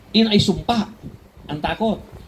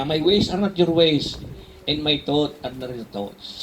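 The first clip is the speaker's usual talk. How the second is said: solemn scripture; 2 semitones lower, slower than usual. Acted. Slow solemn scripture quote.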